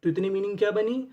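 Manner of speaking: ordinary talk, nothing marked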